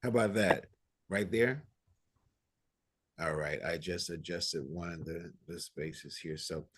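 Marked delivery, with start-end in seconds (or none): 0.0-1.6 louder, checking he's heard; speaking up. Real, not acted. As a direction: raise the voice for the listeners. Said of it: "So I will turn up the volume".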